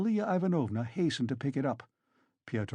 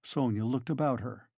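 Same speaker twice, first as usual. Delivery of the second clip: plain reading voice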